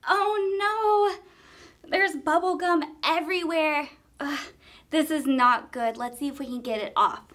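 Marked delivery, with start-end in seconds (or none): none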